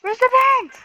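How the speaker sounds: panicked high shout; being chased. Real, not acted. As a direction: yell it in a frantic rush.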